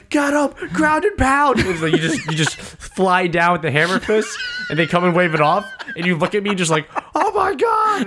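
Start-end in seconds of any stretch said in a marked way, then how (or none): 0.1-1.6 high excited yell; mock cornerman shout. Coach urging his fighter on, high mock shout. 7.1-8.0 high, shouted disbelief; mock amazed cry. Fighter to coach after shock win, mock-astonished shout.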